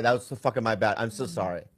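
normal talking voice

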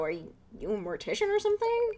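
surprised. Acted. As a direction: caught off guard. Surprised question.

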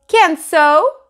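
put-on high, loud childish insistence; a small kid protesting, high and loud; little boy voice. Fudge protests to the dentist, high childish voice. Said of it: "Fudge said".

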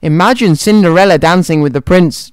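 ordinary delivery, nothing marked

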